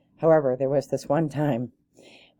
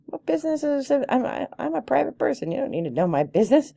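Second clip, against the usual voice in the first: high-pitched indignant retort; bristling, offended push-back; retelling her prickly reply to a nosy organiser, high and indignant; ruffled indignation; 11 semitones higher than usual. Retold or imitated. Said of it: "my feathers got all ruffled".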